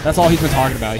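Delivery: ordinary talk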